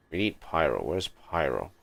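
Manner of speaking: ordinary delivery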